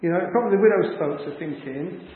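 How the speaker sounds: ordinary delivery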